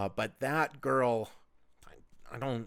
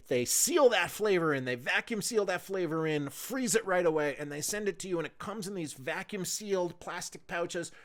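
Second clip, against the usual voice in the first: a lightly hyped sponsor read, higher; 5 semitones higher, 3 dB louder than usual. Acted. Podcast host reads a meat sponsor ad, upbeat. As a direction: sell the smoked meats with a host's pitch.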